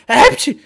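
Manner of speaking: high, loud sneeze. Acted. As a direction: burst out in a squeaky sneeze. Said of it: "çok hapşırıyorlar". (hapşırma)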